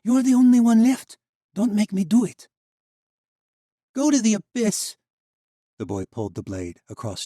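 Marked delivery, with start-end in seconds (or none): 0.0-2.5 high, very loud pleading yell; a woman begging the boy before she fires; desperate shout. Acted. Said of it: "Valeria cried". 3.9-5.0 high, loud spat curse; a dying boy defying the woman; defiant curse. Acted. Jan's defiant last words.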